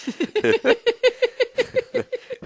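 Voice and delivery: shrieking laughter; high laughing fit. Real, not acted. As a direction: laugh hard after a fright. Laughing after a scare.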